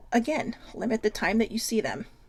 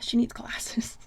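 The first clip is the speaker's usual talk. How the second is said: whispered jab; slower than usual. Real, not acted. Teasing her sister to viewers, whispered. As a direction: whisper it like a secret dig.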